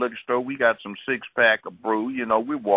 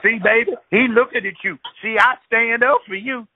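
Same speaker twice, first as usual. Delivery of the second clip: high, louder challenge; high loud hothead picking a fight; hothead boyfriend; 10 semitones higher, 4 dB louder than usual. Retold or imitated. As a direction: bark it at a stranger. Boyfriend showing off to woman.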